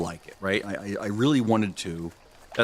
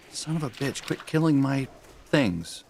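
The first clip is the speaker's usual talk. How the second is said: exasperated, pitched-up swearing at the screen; frustrated outburst; 7 semitones higher than usual. Real, not acted. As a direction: curse it out in sudden annoyance. Game frustration at a rival player.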